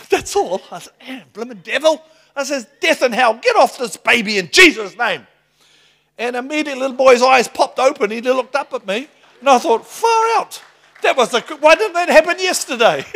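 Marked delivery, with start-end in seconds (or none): 1.0-5.3 loud, high rebuking command; commanding prayer. Retold or imitated. Preacher rebuking death over baby, loud high command. 9.9-13.0 loud, very high laughing astonishment; overjoyed disbelief spilling out; amazed exclamation. Retold or imitated. Preacher recalling the miracle, loud high laughing amazement.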